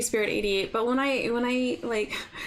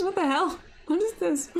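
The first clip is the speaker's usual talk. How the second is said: baffled; 7 semitones higher than usual. Real, not acted. Reacting to chat message.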